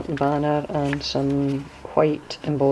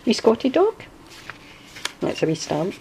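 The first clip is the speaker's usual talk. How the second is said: ordinary delivery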